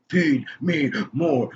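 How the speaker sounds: slow, drawn-out wrestler catchphrase chant; Ryback. Retold or imitated. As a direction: imitate a wrestler's chant, word by word. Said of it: "when Ryback sh-, first showed up".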